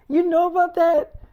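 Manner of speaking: imitating a startled question, pitched high; retold incredulous question; embarrassed disbelief. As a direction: act mortified that he knows. Retelling co-star's embarrassed disbelief.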